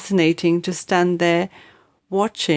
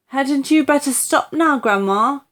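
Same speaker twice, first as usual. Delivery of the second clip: lightly voiced boy, anxious and higher; worried boy; 6 semitones higher, 4 dB louder than usual. A narrator's reading. George to Grandma, worried plea. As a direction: ask her to stop, uneasy.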